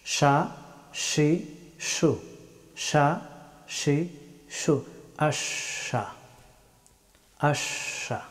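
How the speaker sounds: "সা, সি, সু" said with sh, as sha, shi, shu; mid-tongue to palate, long sh in "আশা"; Arabic letter shin. Shown as a demonstration. Teacher demonstrating shin to learners, drawn-out sh sound. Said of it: "জিহ্বার মধ্যস্থল এবং সোজা".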